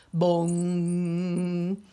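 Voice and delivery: plain reading voice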